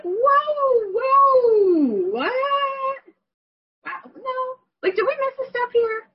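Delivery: high, loud startled whoa, then a stunned no; shocked whoa. Retold or imitated. Reacting to the doctor being an oncologist, shocked and high. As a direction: gasp it out in disbelief.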